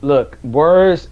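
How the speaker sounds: stumbling over the words, real; repeating words, lost for words; stuttering. Real, not acted. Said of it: "you see how I'm stuttering".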